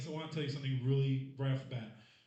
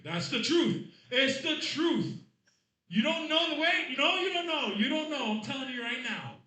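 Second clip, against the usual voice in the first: shouting insistence; 9 semitones higher, 8 dB louder than usual. Real, not acted. Insisting loudly to audience.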